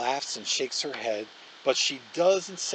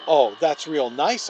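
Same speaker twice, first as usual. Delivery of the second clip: louder, slow sarcastic retort; firing back; 9 dB louder, slower than usual. A narrator's reading. Justin fires back at Olivia, louder and sarcastic. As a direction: hit back with biting sarcasm. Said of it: "I fire back".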